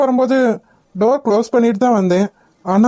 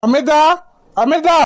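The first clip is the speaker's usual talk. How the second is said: high call to the maid from another room; 5 semitones higher than usual. Acted. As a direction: call her name across the house. Calling the maid from upstairs. (வேலைக்காரியை உரக்க கூப்பிடும் குரல்)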